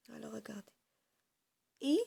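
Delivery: ordinary talk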